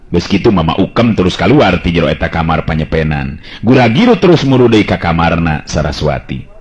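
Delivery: ordinary delivery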